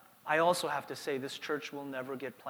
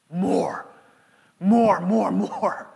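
punchy, raised repeated demand; insistent demand; 8 dB louder, much slower than usual. Acted. Pastor naming what all three applications demand, insistent. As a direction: hammer each repeat with growing force. Hammering home a demanding word.

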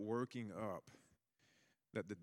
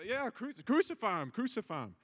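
higher, louder casual agreeing cry; a bystander joining in; 11 semitones higher, 5 dB louder than usual. Retold or imitated. Preacher voicing a neutral onlooker swept along, high, casual cry. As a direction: go along with the mob, lightly voiced. Neutral observer joins the crowd.

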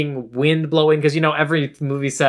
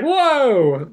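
startled; 8 semitones higher than usual. Retold or imitated. Retold startled reaction to the grab.

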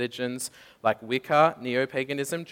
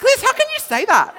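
indignant disbelief; 13 dB louder than usual. Retold or imitated. Indignant question to friend.

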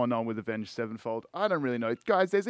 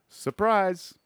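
sarcastic surprise; 7 semitones higher, 5 dB louder than usual. Acted. Sarcastic reveal of bad track.

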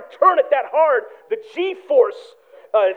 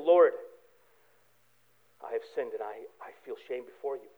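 much softer, lower whispered confession; quiet confession; 12 semitones lower, 11 dB quieter than usual. Retold or imitated. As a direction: confess it low, as if kneeling in shame. Acted confession prayer.

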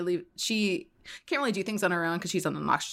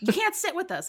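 put-on mean girl; 12 semitones higher than usual. Quoting a mean-girl line.

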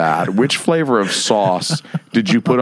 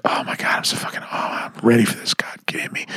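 hushed, eager whisper; breathy whisper; 5 dB quieter than usual. Retold or imitated. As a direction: whisper it like she's in bed.

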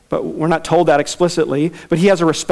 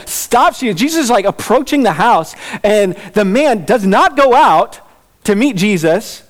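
usual speaking voice

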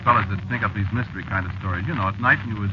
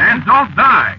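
urgent plea; 11 semitones higher, 6 dB louder, much slower than usual. Acted. Cabbie pleading with strange passenger.

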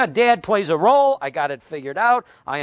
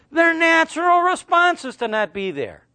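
normal talking voice

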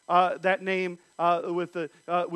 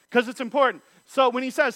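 usual speaking voice

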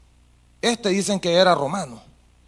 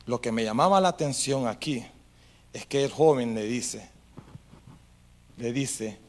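ordinary delivery

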